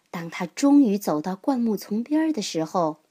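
plain reading voice

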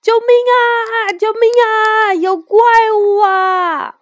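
high, loud cries for help; terrified shouting. A narrator's reading. Ye Gong fleeing from the dragon, panicked shouting. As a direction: shout in panic while running away, pitch held way up. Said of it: "边跑边喊".

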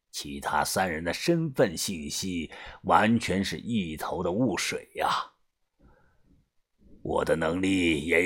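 low, soft, deliberate elder; gang boss confiding. Acted. Boss asking Yunfeng to seek help, low and grave.

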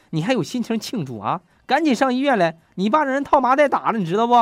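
loud, high-pitched retold fury; angry scolding. Wife scolding husband over the phone, acted fury. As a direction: lay into them, climbing high and loud. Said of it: "女子非常愤怒地说".

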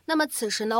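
ordinary talk, nothing marked

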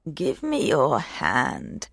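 put-on low, slow old hag voice. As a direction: creak it out like an old crone. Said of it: "said the hag".

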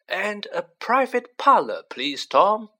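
pointed, firm request. Acted. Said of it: "said Fudge pointedly".

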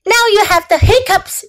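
mild high-pitched yell; mom yelling. A narrator's reading. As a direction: call it out high, as a cross mother. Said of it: "yelled Mom".

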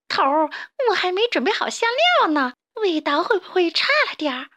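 high, goofy sidekick voice; whiny henchman. Acted. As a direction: fret to the boss in a squeaky whine. Dim henchman frets to boss.

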